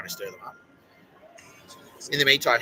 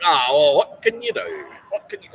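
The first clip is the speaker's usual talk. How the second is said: loud, much higher, dismissive mock shrug; mocking the husband; 6 semitones higher, 9 dB louder than usual. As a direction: ham up his careless shrug. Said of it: "and he's like".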